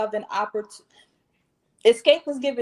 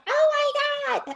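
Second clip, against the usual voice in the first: very high squeaky imitation; imitating Miss Chalet's talking voice; helium voice. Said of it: "almost like a helium type voice".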